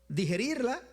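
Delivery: ordinary talk, nothing marked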